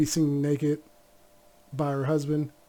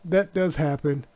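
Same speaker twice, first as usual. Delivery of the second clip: usual speaking voice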